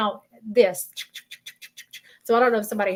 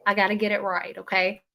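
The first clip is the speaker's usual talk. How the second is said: ordinary talk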